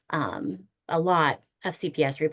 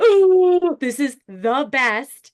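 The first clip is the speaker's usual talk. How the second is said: much higher and louder, gushing delight; thrilled squeal; 8 semitones higher, 8 dB louder than usual. Retold or imitated. Retelling the old man's praise, gushing high squeal. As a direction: squeal it like you just got the best compliment ever. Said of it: "I was like".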